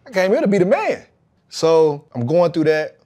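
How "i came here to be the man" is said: pitched way up, indignant protest; a hint of offended pride. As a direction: insist you were brought in to start. Indignant retort after demotion.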